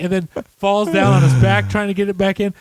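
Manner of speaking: ordinary talk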